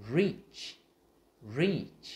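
emphatic, high falling "reach"; every sound clear. Shown as a demonstration. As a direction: model "reach" loudly with R, E and Ch all sounded. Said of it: "phải đọc rõ từng cái".

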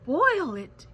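loud, shocked exclamation; gasped. A narrator's reading. Elephant hearing the speck will be boiled, gasped. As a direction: blurt it out, aghast. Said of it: "gasped Horton".

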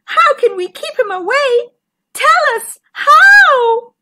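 high-pitched frantic fish; afraid. A narrator's reading. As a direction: plead for help in rising alarm. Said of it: "We're afraid of the shark".

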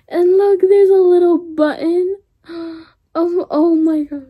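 gasp, then squeal it out high and loud; excited squeal. Real, not acted. Unboxer showing off a little button, squealing. Gushing over a detail.

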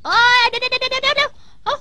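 high, loud pleading to wait; frantic calling. Acted. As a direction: shout after the glove as it flies. Chasing his flying glove.

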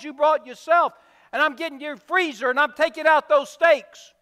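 loud, fast, relentless royal sentence; angry king. Acted. King sentencing the thieving servant, angry and loud. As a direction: pile on the punishments, no mercy.

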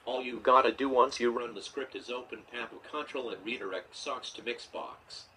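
ordinary delivery

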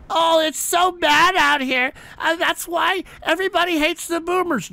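high, whiny mock voice, slowing down; mocking whiny complainer. Host mocks callers whining about boomers, high whiny mockery. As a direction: sneer at them in a nasal whine.

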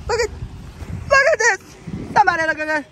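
high, loud outburst; astonished shriek. Real, not acted. Driver showing off the found glove, astonished shriek. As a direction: squeal it for everyone to notice.

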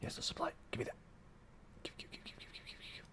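rapid whispered chant of give; whispered chant begging for supply; greedy whisper. Real, not acted. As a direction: hiss it under your breath, greedy.

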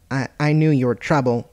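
usual speaking voice

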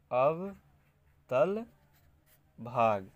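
अवतल split into अव and तल, each stretched and rising, then भाग; slow dictation. Shown as a demonstration. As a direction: dictate अवतल syllable by syllable as if writing. Dictates phrase while writing.

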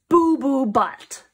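loud, slow, every word punched; big drawn-out declaration; bold announcement. Acted. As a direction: proclaim the silly name with gusto. Book's silly name announced boldly.

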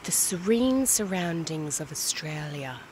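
hushed whispering wildlife narrator; nature documentary. Acted. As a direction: narrate like David Attenborough, low and hushed. Whispered wildlife commentary parody.

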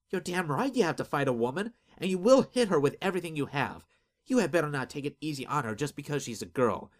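loud angry scolding; forceful, indignant outburst. Acted. Delinda scolds Azric angrily.

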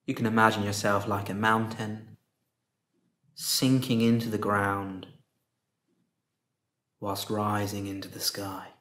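soft, unhurried meditation voice with long pauses; guided meditation. Real, not acted. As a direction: guide them gently into stillness, softly, leaving space after each phrase.

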